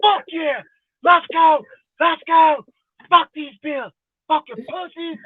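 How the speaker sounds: high-pitched chant of hype; rally the team from your couch; pumped fan cheering. Retold or imitated. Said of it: "I'm like".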